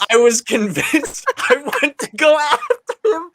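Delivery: high quote broken by laughter; laughing quote. Real, not acted. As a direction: try to read it but crack up. Laughing reading the comic quote.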